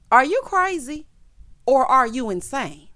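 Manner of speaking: loud and high in disbelief; outraged. Real, not acted. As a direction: yell it at the screen. Outraged rant at Erica.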